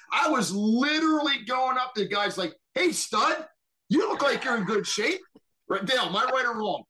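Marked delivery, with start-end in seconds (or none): 2.8-5.2 high, hammed-up buddy-buddy goading; butter him up to bait him; mock-friendly taunt, pitched high; cocky challenge. Goading gym guys into a challenge.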